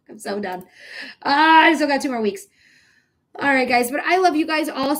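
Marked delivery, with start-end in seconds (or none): 0.1-0.6 quiet. Real, not acted.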